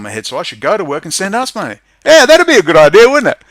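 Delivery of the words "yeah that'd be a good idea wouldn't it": sarcastic outburst. Real, not acted. Sarcastic jab at his audience.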